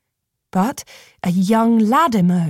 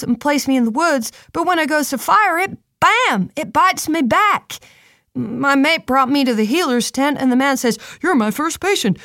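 put-on young lad voice, chatty; 6 semitones higher than usual. Tristo's lad voice, high.

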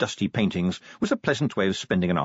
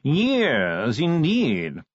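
slow, high, languid aristocratic voice; posh yawning drawl; 4 semitones higher, much slower than usual. Acted. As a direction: drawl every word like a yawn. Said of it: "modulated yawning".

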